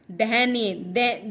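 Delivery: usual speaking voice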